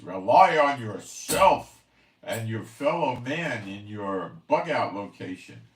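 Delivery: sneering loud parody of prepper advice; mocking their message. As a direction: belt out their message to ridicule it. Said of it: "their message is".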